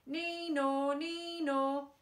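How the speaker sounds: high wailing nee-naw; siren imitation. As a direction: sing out like a police siren.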